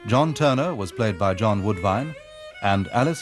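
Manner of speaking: usual speaking voice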